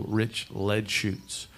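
rich lead shoots spelled out slowly, word by word; slow spelled-out name. Shown as a demonstration. Explaining the song's name to the audience, slowly. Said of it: "which the l".

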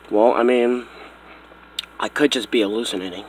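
low, soft, sleepy mumble; groggy. Acted. Buddy just woken up at night replies, groggy. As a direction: answer as if half asleep.

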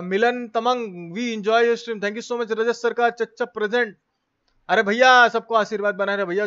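ordinary delivery

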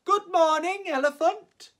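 passing animals call a bright good morning; animals' greeting. Acted. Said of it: "each said to him".

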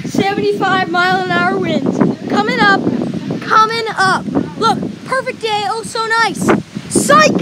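yelling over a storm; loud, high shouting in wind. Real, not acted.

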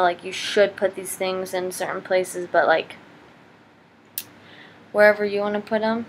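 normal talking voice

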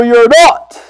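normal talking voice

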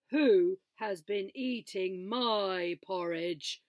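slow, loud booming papa bear; big gruff father bear, slow and loud. A narrator's reading. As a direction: boom it out slowly like a big bear. Father Bear voiced in story.

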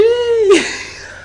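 put-on breathy high-pitched girly giggle; high squeaky giggle. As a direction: giggle way up high like a teen girl. Mocking girls in photos.

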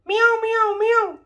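put-on high playful meow for baby; cat meow. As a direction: mew like a tiny cat. Parent voices cat for baby.

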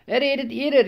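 frightened goats calling to each other. A narrator's reading. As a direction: cry out in alarm. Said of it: "riefen manche".

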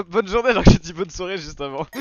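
loud laughing self-mockery. Real, not acted. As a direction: laugh it out loudly. Laughs at own slip.

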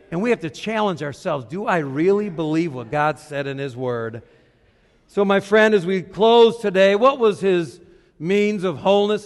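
normal talking voice

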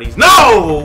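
panicked yell at a jump scare; startled shout. Real, not acted. Gamer hit by a jump scare, panicked shout.

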